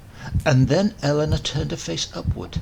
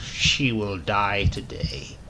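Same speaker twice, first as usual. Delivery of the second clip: slow, lower, smiling certainty; calm smiling affirmation; 6 semitones lower, slower than usual. Acted. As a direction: state it slowly as settled fact. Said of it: "affirmed the professor, still smiling".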